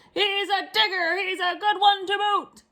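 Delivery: high, slow pirate call; pirate calling to his crew; pirate yell. Acted. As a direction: shout the praise back to the crew. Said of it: "then yelled back to his crew".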